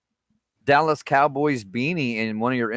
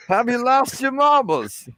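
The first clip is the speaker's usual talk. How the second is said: much higher, slow mock British scolding; British accent; 13 semitones higher, 4 dB louder than usual. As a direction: stretch it out like a shocked British cop. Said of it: "the British accent and all".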